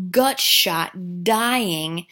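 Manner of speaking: usual speaking voice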